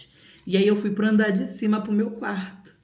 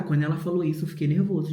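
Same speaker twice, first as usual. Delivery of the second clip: ordinary talk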